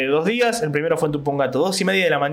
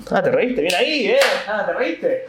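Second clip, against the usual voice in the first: ordinary talk, nothing marked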